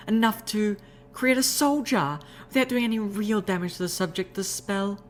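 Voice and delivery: high refined lady's voice, explaining; refined unicorn explaining. Acted. Rarity explaining soul jars, high refined voice.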